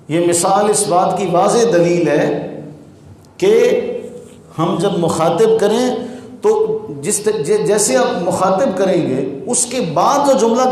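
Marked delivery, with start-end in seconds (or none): none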